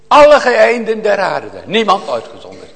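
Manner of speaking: high, loud preaching cry; fervent loud cry. Real, not acted. Preacher crying out to all the ends of the earth, loud and fervent. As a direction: call it out to the back of the church.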